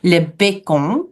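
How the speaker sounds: "bacon" said the French way, bay-KON; French "le bacon" with a nasal ending; French pronunciation. Shown as a demonstration. Teacher shows English word used in French, French pronunciation. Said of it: "We pronounce it le bacon".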